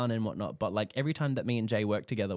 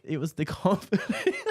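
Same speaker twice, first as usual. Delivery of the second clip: breaking up with laughter, higher pitch; laughing; 8 semitones higher, 4 dB louder than usual. Real, not acted. Cracking up at her own impression of the model, laughing through the words. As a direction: try to speak through real giggles.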